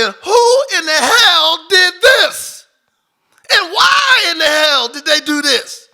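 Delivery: loud, high, slow furious cry. Retold or imitated. Reliving finding his dead horse, furious shout. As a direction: rage and grief bursting out together. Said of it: "And I am furious".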